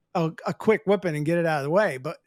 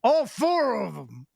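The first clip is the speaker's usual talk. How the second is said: put-on Scottish drunk. Drunk Scot voiced in story.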